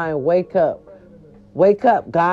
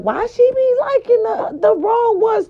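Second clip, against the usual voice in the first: mocking the haters; 12 semitones higher than usual. Mimicking gossiping fans, mock shrill shout. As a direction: parody what the doubters say, shouted. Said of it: "y'all be like".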